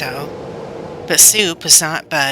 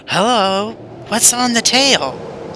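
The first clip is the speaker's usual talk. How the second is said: yell; 7 semitones higher, slower than usual. Acted. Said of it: "he yelled".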